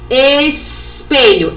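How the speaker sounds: "Espelho" very slow, split after "Es"; slow teaching demo of "Espelho"; sounded-out word. Shown as a demonstration. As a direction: linger on the first sound of "Espelho". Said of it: "começam com o mesmo som".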